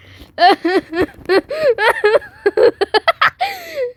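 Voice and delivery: loud, high, long cackling laugh; big laugh. Real, not acted. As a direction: burst out laughing helplessly. Laughing at the game.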